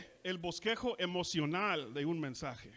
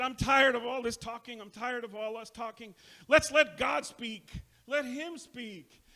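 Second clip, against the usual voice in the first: impassioned preacher; 6 semitones higher, 4 dB louder than usual. Retold or imitated. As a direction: cry out to let God speak. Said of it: "He said".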